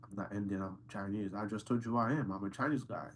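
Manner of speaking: ordinary delivery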